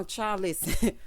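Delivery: ordinary delivery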